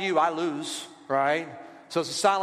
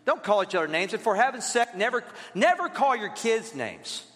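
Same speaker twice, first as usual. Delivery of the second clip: ordinary talk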